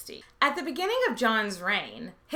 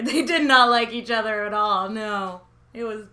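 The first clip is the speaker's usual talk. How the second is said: laughing; 8 dB louder than usual. Acted. Laughing emphasis.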